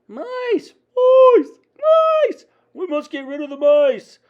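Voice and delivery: very high, loud shrieks; panicked yelling. A narrator's reading. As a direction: shriek it like a crowd in terror. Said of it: "everyone was yelling".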